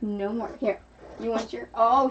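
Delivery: ordinary delivery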